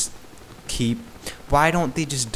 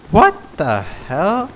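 exasperated; 6 semitones higher, 4 dB louder, slower than usual. Real, not acted. Ranting about English spelling.

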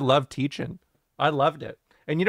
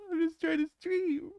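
high whiny streamer voice, much softer; mocking whiny streamer, softer; 12 semitones higher, 7 dB quieter than usual. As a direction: repeat the whine under your breath, much softer. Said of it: "I hate when streamers do that".

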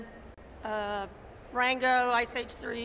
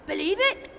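"Believe it" in a higher put-on Scottish accent; a quick try at a Glaswegian accent; 6 semitones higher than usual. Shown as a demonstration. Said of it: "In a Scottish accent?".

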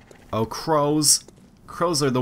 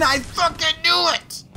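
high, slow, drawn-out yell; exasperated outburst; 4 dB louder than usual. Real, not acted. Player hit by the cannon trap he expected, exasperated. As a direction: burst out high, stretching every word.

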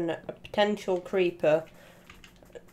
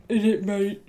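mock British accent. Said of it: "making fun of British culture".

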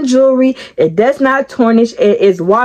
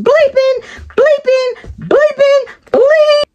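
high squeaky bleeps standing in for curses; mock censored swearing; slower than usual. Cursing in her head, bleeping out the words comically. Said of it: "at that point furious".

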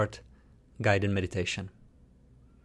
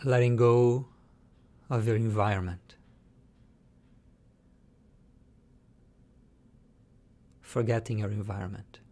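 guided meditation. Shown as a demonstration. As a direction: lead them calmly, letting each phrase settle into silence.

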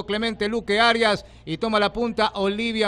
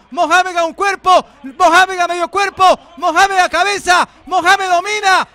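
rising higher and louder to a shout; building excitement; 4 semitones higher, 3 dB louder than usual. Real, not acted. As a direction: build the call as the horses close in. Final stretch of race. (narración cada vez más emocionada)

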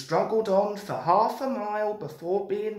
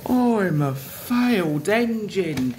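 mournful character wail; sorry-for-himself complaint; moaning; 2 semitones higher, 4 dB louder than usual. A narrator's reading. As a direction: moan it in self-pity. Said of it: "he moaned".

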